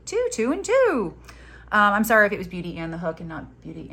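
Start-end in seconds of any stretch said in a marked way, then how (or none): 0.1-1.1 much higher, slow sing-song two, two, and two; high slow playful sing-song count; Allie's catchphrase. Retold or imitated. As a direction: chant it high and drawn out like a friend's catchphrase. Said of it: "As Allie of Beauty and a Hook would say".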